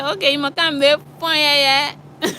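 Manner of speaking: high teasing Liberian English; telling friends, high Liberian English tease; Liberian slang. Retold or imitated. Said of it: "I say".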